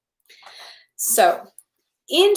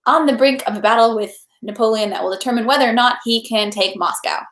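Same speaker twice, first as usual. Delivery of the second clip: ordinary delivery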